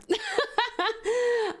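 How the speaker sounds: high villainous cackle; maniacal laugh. Acted. Anaconda laughing like his brother, maniacal cackle. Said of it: "just like his big brother".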